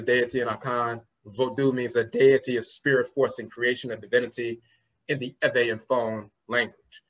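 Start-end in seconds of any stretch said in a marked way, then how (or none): none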